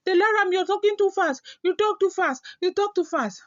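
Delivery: high, fast mimicking of complaining viewers; mock viewer voice, high and quick; viewers' complaint. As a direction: squeak it out like a nagging fan. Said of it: "They say".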